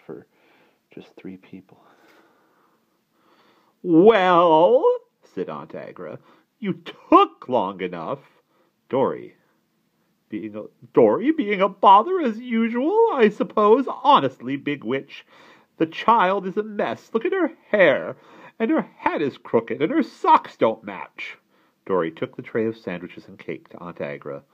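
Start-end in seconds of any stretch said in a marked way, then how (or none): 3.8-4.8 high, loud, demanding "well"; impatient aunt. A narrator's reading. Impatient aunt greets the tray. 6.6-21.4 high, loud scolding; nagging, critical aunt. A narrator's reading.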